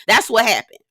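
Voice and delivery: ordinary delivery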